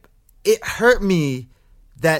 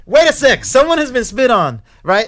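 much higher and louder, exploding in outrage; angry outburst; 7 semitones higher, 6 dB louder than usual. Retold or imitated. Confronting housemates who defend the spitter, angry shout. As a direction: let it all out at them. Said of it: "I just lose my stuff".

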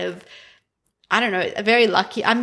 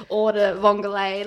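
put-on Italian accent. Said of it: "with my very beautiful Italian accent".

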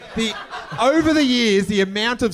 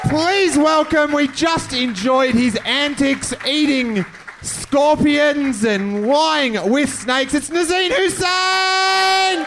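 slow, high announcer build to a shouted name; big live-show MC intro; stage introduction; 7 semitones higher, 3 dB louder, slower than usual. Real, not acted. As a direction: draw it out, then holler the guest's name.

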